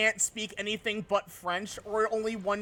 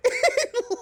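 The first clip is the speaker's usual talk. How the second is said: ordinary talk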